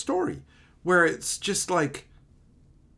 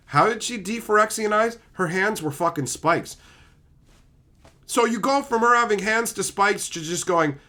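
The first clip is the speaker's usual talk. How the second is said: ordinary talk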